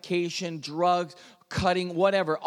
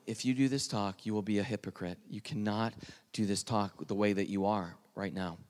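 soft, low, insistent inner voice; quiet inner voice; 7 semitones lower, 8 dB quieter than usual. Retold or imitated. Holy Spirit's voice repeating to the student before his talk, soft and insistent. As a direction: let it echo softly in the head. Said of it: "just the word over and over".

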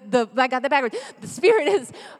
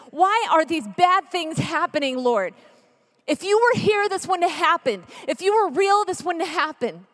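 higher, louder pleading protest; anguished challenge; 4 semitones higher, 3 dB louder than usual. Acted. Believer demanding answers from the Lord after tragedy, anguished. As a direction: cry out in accusation to God.

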